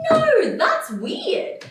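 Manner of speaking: very high, loud, drawn-out protest; mock dramatic. Woman rejecting her date's doctor advice, over-the-top dramatic protest. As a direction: wail it with hammed-up drama. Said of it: "he wasn't doing the drama".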